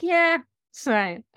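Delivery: slow breezy dismissal; nonchalant. Retold or imitated. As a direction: shrug it off slowly. Unbothered reply.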